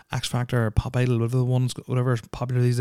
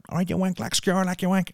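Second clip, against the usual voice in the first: raised-pitch take on a local slang talker; lightly mock the locals, pitched up; mimicked local slang; 7 semitones higher than usual. Host imitating locals you'd bump into, in high-pitched slang. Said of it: "they were like".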